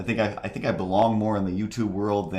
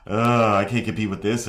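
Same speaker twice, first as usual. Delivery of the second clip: exasperated; 2 semitones higher, 5 dB louder than usual. Retold or imitated. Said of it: "where I'm like".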